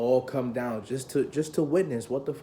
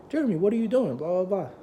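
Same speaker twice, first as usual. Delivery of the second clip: higher-pitched teacher's question; surprised teacher; 7 semitones higher, 3 dB louder, faster than usual. Retold or imitated. Band teacher to student who walks in, surprised. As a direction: lightly imitate a startled teacher.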